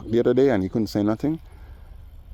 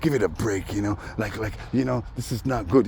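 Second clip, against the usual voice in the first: hushed pleading; 4 dB quieter than usual. Retold or imitated. Imitating Dave pleading quietly.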